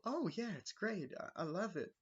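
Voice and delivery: high, gushing mock-Wendy; imitating Wendy. Wendy gushing to visitors in a high chirpy voice.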